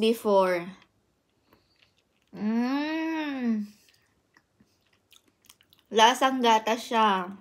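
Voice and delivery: talking while chewing food; muffled words between bites; mouth full, chewing. Real, not acted. Seen 0: Bites raw salmon at 0.2, chews while talking, holds slice.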